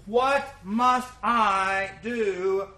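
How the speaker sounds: very slow, dwelling on every word; slow solemn question. Retold or imitated. Preacher quoting the jailer to the church, slow and weighty. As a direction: let the jailer's plea hang in the air.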